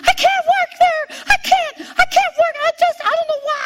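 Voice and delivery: high, loud, fast, sobbing wail; retold wail of a crying young wife. Husband asks what's wrong, she wails back crying. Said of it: "I'm bawling my eyes out".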